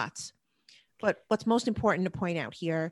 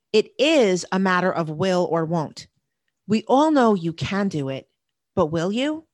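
louder, punching will and won't; emphatic challenge; 7 dB louder than usual. Real, not acted. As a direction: press the point home.

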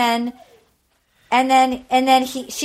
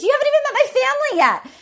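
mock incredulous him; 12 semitones higher, much faster than usual. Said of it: "He goes".

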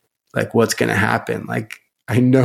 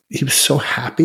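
usual speaking voice

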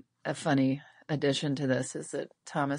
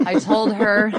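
louder and higher, laughing as it comes out; real laughing; 5 semitones higher, 8 dB louder than usual. Real, not acted. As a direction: laugh while saying it. Laughing with host over news.

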